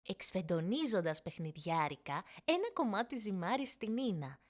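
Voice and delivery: ordinary delivery, nothing marked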